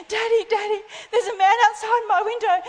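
put-on high-pitched panicky kid calling for Dad; hysterical child. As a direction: imitate a frightened child, voice pushed high. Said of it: "I was hysterical".

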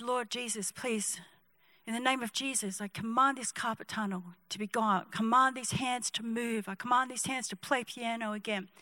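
very soft, low, earnest praying; hushed prayer. Acted. Kid praying for crying pianist's hands, hushed prayer. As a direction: pray it quietly over the hands.

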